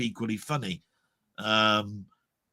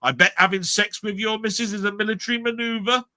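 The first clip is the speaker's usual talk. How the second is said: quoting the sitcom; 9 semitones higher than usual. Retold or imitated. Man retelling a rude sitcom line, higher mock delivery. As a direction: deliver it as a cheeky punchline. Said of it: "where he says".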